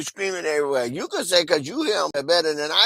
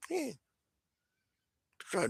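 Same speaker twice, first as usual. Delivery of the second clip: ordinary talk, nothing marked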